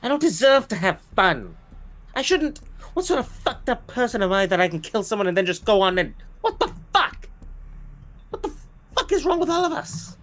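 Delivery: loud, high, guilt-stricken cries; anguished outburst. Acted. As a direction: spiral into panicked guilt.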